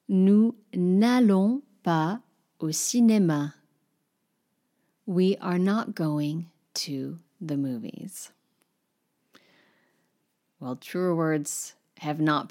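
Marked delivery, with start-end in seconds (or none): 0.1-3.5 very slow, careful French sentence; French model. Shown as a demonstration. Teacher models example sentences for learners, very slow. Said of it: "other examples". 5.1-8.3 whispered translation. Shown as a demonstration. Teacher whispers the English meaning after the French, slowly. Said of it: "translation after example".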